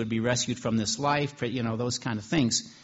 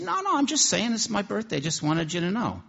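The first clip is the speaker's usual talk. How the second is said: high mock woman's voice; 9 semitones higher than usual. Prostitute telling her friend about her birthday, high-pitched. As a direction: voice lifted much higher, insistent.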